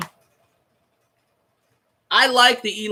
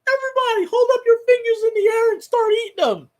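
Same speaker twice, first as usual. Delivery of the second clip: much higher, shouted hype-man voice; exaggerated squeaky locker-room chant; mocking Winston; 12 semitones higher than usual. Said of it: "He's like".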